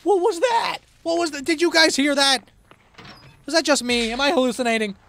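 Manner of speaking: high, loud panicky questions, easing slightly; alarmed outcry. Real, not acted. Streamer to viewers, did you hear that, high alarmed shouting. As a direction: call out in startled disbelief. Alarmed by strange game noise.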